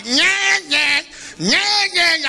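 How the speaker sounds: high whiny nyah nyah; mock duckling cries. Ducklings crying for their mama, high whiny imitation. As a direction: whine like abandoned baby ducks.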